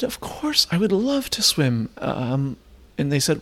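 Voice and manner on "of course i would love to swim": high, sing-song mock delight; eager acceptance. Telling the hosts he'd love to swim, high mock delight. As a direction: chirp it up like an overeager guest.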